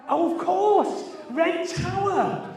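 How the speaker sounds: loud, high delighted recall; excited. Real, not acted. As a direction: blurt it out with sudden recognition. Sudden recall of the answer.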